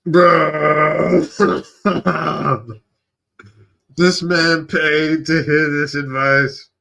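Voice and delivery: loud, high disbelief breaking into laughter; incredulous laughing. Real, not acted. Reactor learns a man paid for this advice, laughing incredulously. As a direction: drag it out loudly through laughs.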